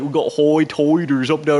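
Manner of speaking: exaggerated Ocracoke brogue, loud and high; loud mock island brogue. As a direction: ham up an Outer Banks brogue. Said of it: "they talk like".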